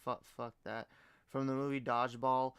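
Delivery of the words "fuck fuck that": usual speaking voice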